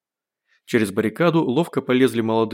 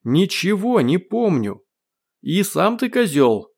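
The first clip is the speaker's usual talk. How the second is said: high, drawn-out retort; defiant shout; 7 semitones higher, slower than usual. A narrator's reading. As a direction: yell back stubbornly, slowly. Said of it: "крикнул гость". (упрямый выкрик)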